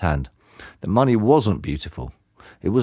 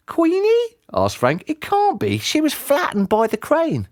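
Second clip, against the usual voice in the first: put-on high boyish voice in disbelief; the boy can't believe it; boy's voice, astonished; young boy, amazed. A narrator's reading.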